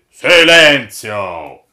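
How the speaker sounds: booming, drawn-out command; thundering. A narrator's reading. Old master silencing the crew, thundering. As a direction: thunder it slowly at full volume. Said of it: "tuonò papà Catrame".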